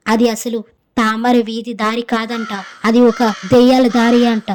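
ordinary delivery, nothing marked

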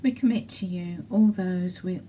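ordinary talk

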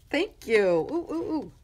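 giggly thanks rising into high coos; excited. Real, not acted. Thanking the sender for the gift, excited. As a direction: bubble over with delight. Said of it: "I got a little excited there".

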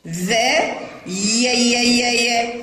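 ذا, pause, then slow chained "ya" on each يا; yaa pronounced. Shown as a demonstration. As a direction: sound out each يا separately. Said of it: "حرف الياء ينطق ولا يمد". (نطق يا مكررة بعد ذا)

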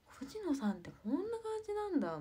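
a classmate pleasantly caught off guard; surprised classmate. Pretend. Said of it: "みたいな".

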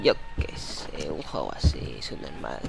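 hushed dismissive aside; whispered aside. Real, not acted. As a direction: mutter it under your breath. Muttered aside.

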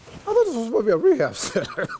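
incredulous laugh at the surprise; laughing disbelief. Real, not acted. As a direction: laugh in amazed realization. Realizes it's a nursing home.

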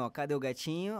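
soft, low call for a kitten; soft kitty call. Shown as a demonstration. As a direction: coax the kitten out gently. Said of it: "só chamar ele assim".

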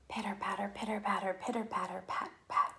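whispered pitter patter; soft whispered raindrops; soft rain sounds. A narrator's reading. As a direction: hush it like light rain on the roof. Rain sound read softly.

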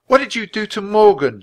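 put-on high, loud, accusing girl; high-pitched furious outburst. A narrator's reading. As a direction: demand an answer angrily. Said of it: "turned to the Christmas knight in a fury".

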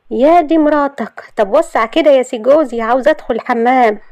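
high, loud, fed-up retort; exasperated Shahd. Acted. Shahd telling her so-called husband to move, exasperated.

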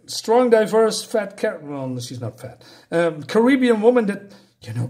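ordinary delivery

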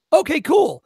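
loud, high mock enthusiasm; bouncy put-on okay; cheery acceptance. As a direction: imitate an onlooker happily agreeing. Imitated cop cheerfully accepts a no.

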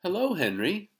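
high, loud lady voice, rising lilt; cheery librarian greeting. A narrator's reading. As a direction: greet a young regular in sing-song.

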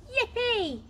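put-on high, loud child's cheer of joy; excited shout. A narrator's reading. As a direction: squeal it out with delight. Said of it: "shouted Billy".

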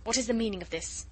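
fast, anxious question; quick question. Acted. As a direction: rush the question out. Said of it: "he asked quickly".

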